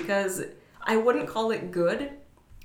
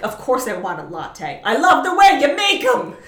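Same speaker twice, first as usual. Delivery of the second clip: gushing; 5 semitones higher, 12 dB louder than usual. Retold or imitated. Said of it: "he's like".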